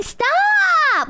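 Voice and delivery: imitating her stop. Streamer copies the arrested woman's "Stop!", high mock cry. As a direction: copy her cadence on "Stop!". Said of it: "the way she's saying".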